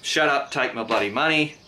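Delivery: ordinary talk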